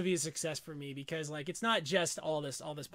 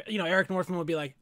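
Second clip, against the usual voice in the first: ordinary delivery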